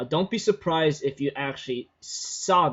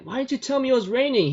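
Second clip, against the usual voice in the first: mock complaint; 9 semitones higher, faster than usual. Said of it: "she complains".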